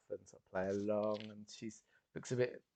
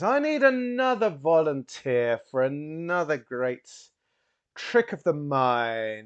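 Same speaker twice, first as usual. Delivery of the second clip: loud, slow stage announcer; rising booming call to the crowd; showman calling out; 6 semitones higher, 9 dB louder, slower than usual. Acted. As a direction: draw out the invitation grandly. Bowman calls for volunteer.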